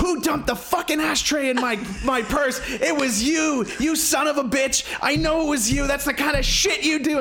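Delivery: high-pitched mock tirade; retold furious rant. Co-host replaying Melanie reaming him out, high angry imitation. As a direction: imitate her chewing you out, hammed-up. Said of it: "reamed me out in front of everybody".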